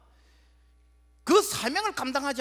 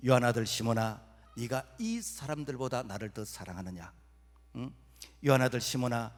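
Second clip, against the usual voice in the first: tender Jesus; 7 semitones lower than usual. Acted. Jesus gently asking Peter thrice.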